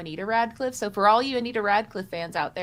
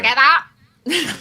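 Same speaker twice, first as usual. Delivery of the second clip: high loud very slow cry; heroic rally; 5 semitones higher, 10 dB louder, much slower than usual. Acted. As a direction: bellow it grandly.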